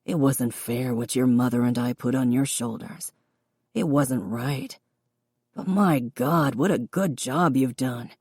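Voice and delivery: a hint of a gentle, proud father. Father's voice held.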